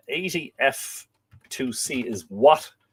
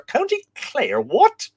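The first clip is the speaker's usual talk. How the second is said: high-pitched incredulous echo, louder on the last word; mock disbelief; 4 dB louder, much slower than usual. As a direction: query it in mock astonishment. Mock disbelief at viewer's location.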